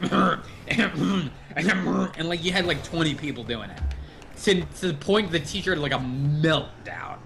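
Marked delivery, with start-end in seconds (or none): 0.2-1.5 repeated, exaggerated throat-clearing; imitated throat clearing. Whole class pestering the teacher, mock throat-clearing. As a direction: clear your throat over and over. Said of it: "clear his throat in class".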